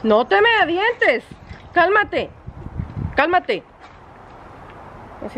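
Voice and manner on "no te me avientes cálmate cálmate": soothing command to dog. Real, not acted. Dog throwing itself at her.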